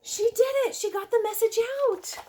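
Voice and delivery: overjoyed that the snail succeeded; delighted cheer. Pretend. Reader celebrates rescue plan.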